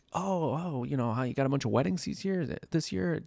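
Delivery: lightly voiced chatty acquaintance, pitched high; mock eager small talk. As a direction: imitate people making keen wedding small talk, fast in the middle. Acts out curious small-talkers.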